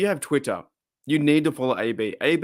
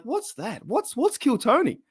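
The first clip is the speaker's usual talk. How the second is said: much higher, mock-baffled question; puzzled surprise; 12 semitones higher, slower than usual. Retelling his first reaction to the show, high and puzzled. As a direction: play your younger self hearing of it for the first time. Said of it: "And I was like".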